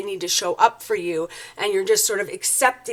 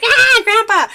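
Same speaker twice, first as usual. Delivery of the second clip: much higher, louder shocked cry of reproach; exasperated scold; 12 semitones higher, 9 dB louder than usual. Retold or imitated. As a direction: yelp it out like a shocked grandkid. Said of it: "you're like".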